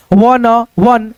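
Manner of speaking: drawn out slowly, each syllable apart; slowly sounded वन; slow reading. Shown as a demonstration. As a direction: read it slowly like a first-time learner. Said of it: "पहले जो है ना slowly एकदम".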